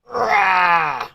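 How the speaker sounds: high, falling "raah"; drawn-out cry. Real, not acted. Watcher reacting to the fight, drawn-out cry. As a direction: let out a long high cry.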